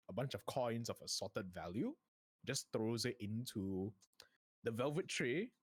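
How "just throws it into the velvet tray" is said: ordinary talk, nothing marked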